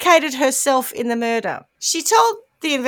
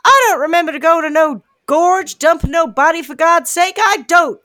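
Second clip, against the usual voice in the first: put-on loud, higher down-home country accent; down-home Oregon accent; 5 semitones higher, 6 dB louder than usual. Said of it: "I don't know if he has that accent".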